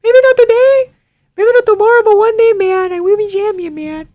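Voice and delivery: very high squeaky voice impersonating Guru; Guru impression. Streamer impersonates Guru for his chat. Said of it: "I can do".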